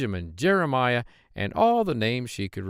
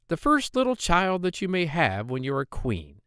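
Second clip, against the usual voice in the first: higher, squeaky voice of a hobbling little man; droll little man; 7 semitones higher than usual. Acted. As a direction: pitch him up high and sly. Dwarf demands the child.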